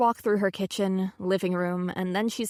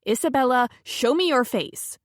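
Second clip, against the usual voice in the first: loud, stern command by name; stern friend; 4 semitones higher, 5 dB louder than usual. Acted. Friend demanding to see her face, stern and loud. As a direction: order her to show her face. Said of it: "answers in a stern voice".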